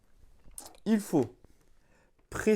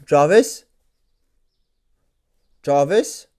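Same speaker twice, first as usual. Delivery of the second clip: calling out a wake word; 2 semitones lower, 8 dB louder, slower than usual. Real, not acted. Calling the assistant.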